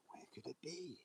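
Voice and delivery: breathy unvoiced mock whisper to himself. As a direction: hush it like a playful secret search. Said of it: "Use my senses".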